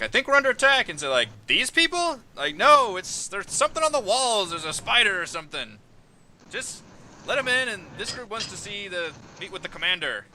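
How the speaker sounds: high, loud alarmed guard. Acted. Guard arguing about the attack at the gate, high alarmed voice. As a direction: raise the voice high and urgent. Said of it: "one of them says".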